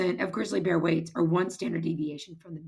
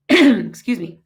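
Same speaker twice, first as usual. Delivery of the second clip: losing her voice, hoarse and strained; hoarse after clearing the throat; 10 dB louder, slower than usual. Real, not acted. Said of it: "Sorry, I'm losing my voice".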